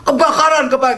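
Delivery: Kebakaran called out high with a laugh; hammed-up high fire alarm yell; mock alarm shout. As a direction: imitate a friend yelling fire, half laughing. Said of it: "dia teriak-teriak".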